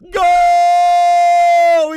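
long held goal yell; goal shout. Real, not acted. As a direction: hold the goal call out full force. Goal scored, long call.

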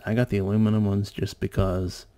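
normal talking voice

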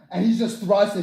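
ordinary delivery

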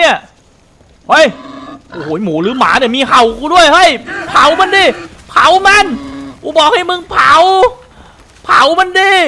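loud, high shouted orders; frantic yelling. Real, not acted. Gamer yelling at his partner to burn the barking pig, frantic shout. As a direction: bark commands in a rising panic.

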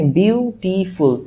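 বিউটিফুল divided into three distinct beats; syllable-by-syllable demonstration. Shown as a demonstration. Teacher proving it takes three beats, বিউটিফুল split by syllable. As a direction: sound out বিউ-টি-ফুল separately. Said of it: "তিনবারই উচ্চারণ করতে পারতেছো".

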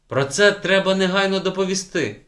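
loud, high indignation; indignant outburst. A narrator's reading. Teacher red as a beet, loud indignant outburst. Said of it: "Вчителька почервоніла, мов буряк".

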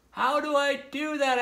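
high-pitched anxious question; storybook character in a fix; helpless calling. A narrator's reading. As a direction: call down for help in a high voice. Stuck turtle calling down for advice.

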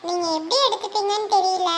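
ordinary talk

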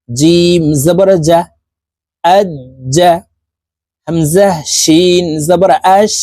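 slow, measured letter-by-letter recitation; spelling drill. Shown as a demonstration. Teacher spelling syllables for learners, slow measured drill. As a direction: spell it out, then blend the syllables.

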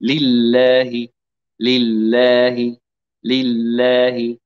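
لله with a thin clear lam, slow; light lam. Shown as a demonstration. Teacher leading repetition of the thin lam. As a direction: say لله with the tongue tip light, no heaviness. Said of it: "هنا قولوا معي".

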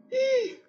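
high startled intake of breath; sharp gasp. Real, not acted. Streamer spotting a figure in the house, sharp gasp. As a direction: gasp in real fright at the screen. Sees something inside the house.

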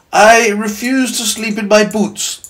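high, slow centipede protest; indignant cry. Acted. Centipede objecting to bedtime in boots, crying out high. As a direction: refuse it high and drawn-out. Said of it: "the centipede cried".

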